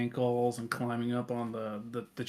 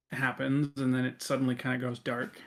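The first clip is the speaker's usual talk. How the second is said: ordinary delivery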